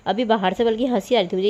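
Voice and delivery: normal talking voice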